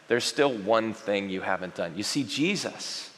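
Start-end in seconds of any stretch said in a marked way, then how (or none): 0.1-2.0 hushed, tender retelling. A narrator's reading. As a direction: read it gently, letting love fall. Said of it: "Jesus felt genuine love".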